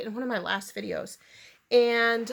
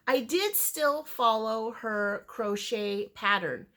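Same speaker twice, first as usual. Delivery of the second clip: usual speaking voice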